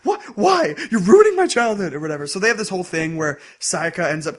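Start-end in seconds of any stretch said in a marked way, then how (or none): 0.1-1.9 high, loud squeal of indignation; mock outrage. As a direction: squeal like your childhood just died. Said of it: "And he's like".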